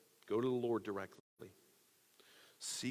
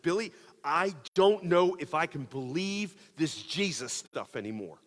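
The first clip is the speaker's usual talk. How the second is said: loud, high, spilling over; pouring it all out at once; desperate outburst; 6 semitones higher, 8 dB louder than usual. Retold or imitated. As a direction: blurt it out to your friend in despair. Unloading on friend on phone.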